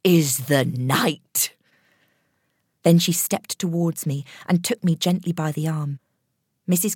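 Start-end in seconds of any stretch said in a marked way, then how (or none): 0.0-1.5 slow, firm and certain; very definite. A narrator's reading. Old woman to the gathering, very definite. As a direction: lay it down as settled fact. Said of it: "very definitely".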